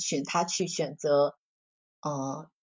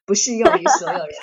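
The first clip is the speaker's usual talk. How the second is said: higher, louder, through a laugh; 9 semitones higher, 6 dB louder than usual. Real, not acted. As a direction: laugh while adding the disclaimer. Laughs at own narrow view. (笑着说)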